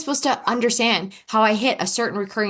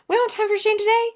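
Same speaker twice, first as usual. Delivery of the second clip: much higher, fast, cutesy pet-talk; playful baby talk; 14 semitones higher, faster than usual. Pretend. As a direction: coo at it like a puppy you're shooing off. Cooing to her shame like a pet.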